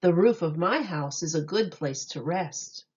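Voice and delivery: ordinary delivery, nothing marked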